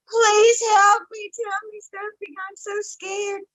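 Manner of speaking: high, pleading voice of a woman in tears; retold frightened plea. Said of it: "And I'm crying".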